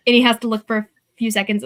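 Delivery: ordinary delivery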